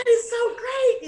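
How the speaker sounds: high, slow, laughing gush; excited and laughing. Real, not acted. Young singer thrilled to work with [name], laughing high. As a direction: gush with delight through laughter. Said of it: "I was so excited".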